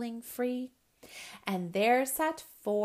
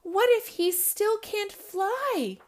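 high, loud alarmed question; worried cry; 11 semitones higher, 7 dB louder than usual. Acted. Owlet fears sibling can't fly, crying out. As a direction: cry it out anxiously. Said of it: "cried three".

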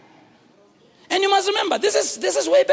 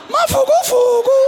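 high, loud called-out name; shouted name; 11 semitones higher, 6 dB louder than usual. Acted. Answering the question about his name, high loud shout.

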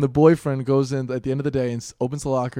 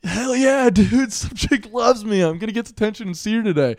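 higher-pitched, thrilled bragging; imitated giddy excitement; 8 semitones higher, 4 dB louder than usual. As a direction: play a kid thrilled by a love note. Acts out gullible kid's excitement.

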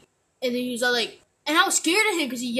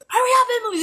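impatient partner; 9 semitones higher, 5 dB louder, much faster than usual. Retold or imitated. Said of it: "they're like".

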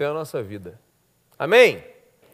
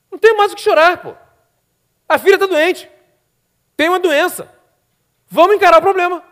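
much higher, louder fervent preaching; preaching at full force; 10 semitones higher, 10 dB louder than usual. Acted. Pastor urging the church to stop weeping, fervent preaching. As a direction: exhort the congregation to face the problem.

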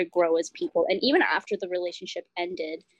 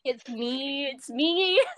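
playful gloating; 7 semitones higher, much slower than usual. Pretend. Teasing gloat after "in your face.

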